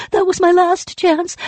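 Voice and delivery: very high loud wail; despairing wail. Acted. Spirit wails over its lost chance, very high and loud. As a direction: cry it out at full pitch.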